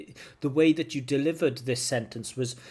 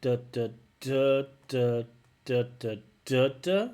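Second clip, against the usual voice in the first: choppy, evenly punched "duh" beats rising at the end; mechanical, unsure rhythm. Shown as a demonstration. Teacher showing an unsure intonation on "duh", mechanical and choppy. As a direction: space out each "duh", lift the last one. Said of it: "It sounds unsure".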